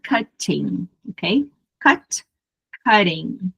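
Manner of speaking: "cutting" in British English with a crisp T; British-style "cutting", T fully sounded; British pronunciation. Shown as a demonstration. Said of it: "pronúncia mais britânica".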